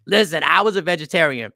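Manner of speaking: ordinary talk, nothing marked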